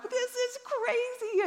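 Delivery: high, slow, drawn-out disbelief; amazed. Retold or imitated. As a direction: savor the wonder of it. Blown away by tongues.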